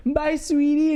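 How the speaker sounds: high-pitched mock-sweet goodbye; cooing cat lady. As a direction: imitate a cat lady cooing to her pet.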